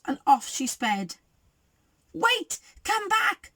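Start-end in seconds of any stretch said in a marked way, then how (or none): 2.1-3.5 high-pitched calling out; yell. A narrator's reading. Bears call after Goldie's truck, high yell. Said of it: "yelled the bears".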